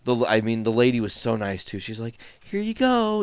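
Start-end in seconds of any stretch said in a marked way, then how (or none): 2.4-3.2 high, sweet and friendly; a bright, friendly cashier; super friendly. Retold or imitated. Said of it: "super friendly about it".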